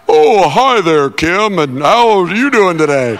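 mock smooth greeting. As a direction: ham up a suave assistant's greeting. Mimics Siri's friendly greeting, mocking.

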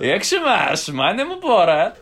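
Borat. Shown as a demonstration. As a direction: greet them in a Kazakh Borat accent. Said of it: "My best impression is Borat".

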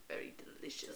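very soft, whispered relish; low-volume swooning whisper; hushed savouring. Real, not acted. Playfully savouring how he looks.

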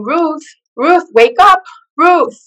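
urgent wake-up call. Retold or imitated. Nurse slapping her awake.

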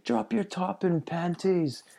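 high, slow Irish guy voice; quoting an Irish mechanic; Irish accent. Retold or imitated. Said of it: "this Irish guy was running around the shop one day just saying".

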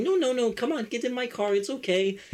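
high-pitched insistent granny voice; coaxing old lady. Retold or imitated. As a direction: wheedle like a pushy old woman. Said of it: "was pressuring me to get into her car".

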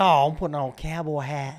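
loud, hammed-up Texan cowboy; Texas twang. Teasing co-host about a Texas trip, loud mock Texas twang. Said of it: "come back with a Texas twang in my voice".

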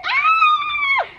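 very high ecstatic shriek; shrill celebratory yelp; joyful scream. Retold or imitated. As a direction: let out a scream of pure joy. Joy at herpes-free news.

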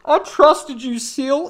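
gasp, then wail the betrayal; mock betrayed gasp.

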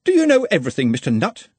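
high-pitched, pedantic sarcasm; a schoolmaster needling a know-all pupil; sarcastic. A narrator's reading. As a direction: sarcasm starting high, then dropping. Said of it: "with the sarcasm of a born pedagogue".

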